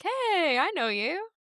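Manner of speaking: high, loud greeting to someone known; mock surprised recognition. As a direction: call out as if spotting an old acquaintance. Acts out surprised recognition of acquaintance.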